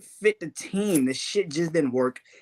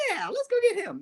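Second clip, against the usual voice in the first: much higher, laughing mock eagerness; mock front office; faster than usual. Mocking Denver's front office chasing Russell Wilson, high and giddy. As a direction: squeak it out like a clueless front office. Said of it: "It's just like".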